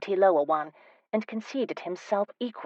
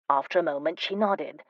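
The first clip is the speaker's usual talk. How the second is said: plain reading voice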